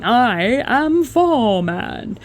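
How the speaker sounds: very slow, loud bogan voice, I am four man; hammed-up slow council worker boast. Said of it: "a council worker standing on the side of the road".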